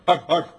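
mock dog huffing. Someone trying to bark, a loud mock huff. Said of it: "Somebody else goes".

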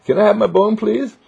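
high, sweet pleading dog voice with a laugh; Rex, said with love. Retold or imitated. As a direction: beg for the bone like a cheerful puppy. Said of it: "He just said it with love".

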